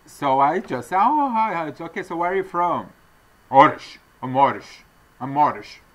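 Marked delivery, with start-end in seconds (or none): 1.0-2.9 high-pitched retold greeting; friendly small talk. 3.5-5.8 imitated foreign-accented English. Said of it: "he has, has an accent".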